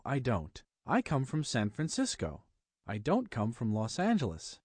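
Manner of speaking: ordinary talk, nothing marked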